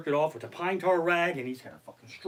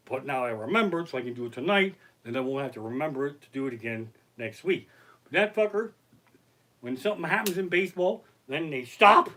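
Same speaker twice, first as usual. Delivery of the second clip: ordinary delivery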